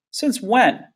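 high, sneering challenge. A narrator's reading. Friend doubting the sister's vegetarian claim, sneering. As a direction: sneer it as a sceptical question. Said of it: "Mike sneered".

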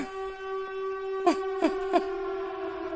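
airy unvoiced laugh; breathy laugh. Real, not acted. Gamer laughing at own pizza joke, breathy laugh. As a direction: laughs off the fright.

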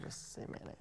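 whispered aside; whispering. Real, not acted. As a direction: whisper it under your breath. Aside to interviewer off mic.

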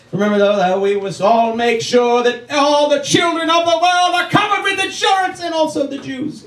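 rising to a high shouted rant; ranting like a dictator at a rally; mock Hitler speech.

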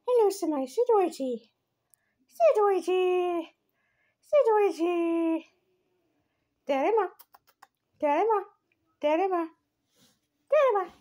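put-on high, cooing baby talk to the cat; pet talk. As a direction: coo at the kitty like a baby. Cooing to her cats.

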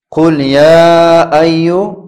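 یا held long before ایو; long madd. Shown as a demonstration. Teacher shows students the madd on یا, held long. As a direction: stretch the vowel of یا as a long madd. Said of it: "یک مد است".

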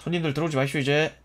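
ordinary delivery

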